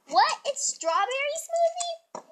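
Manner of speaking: squeaky high shocked question; shocked disbelief. Real, not acted. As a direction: sound stunned by the answer. Shocked at wrong answer.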